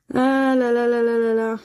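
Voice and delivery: long fed-up sigh of words; exasperated. Real, not acted. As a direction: groan at another losing ticket. Ticket turns out a loser.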